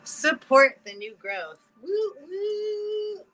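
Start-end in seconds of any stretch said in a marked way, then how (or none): 1.8-3.2 high, drawn-out "woo woo"; celebratory whoop. Real, not acted. Speaker cheering new growth, celebratory whoop. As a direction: whoop high and hold the last one.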